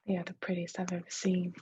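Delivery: ordinary delivery, nothing marked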